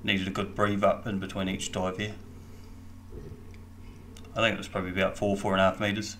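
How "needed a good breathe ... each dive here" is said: ordinary delivery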